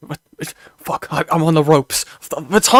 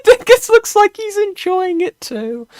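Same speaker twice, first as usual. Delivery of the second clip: squeaky laughing voice; laughing high squeal; 6 dB louder than usual. Real, not acted. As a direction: giggle it out high. Laughing mock comment.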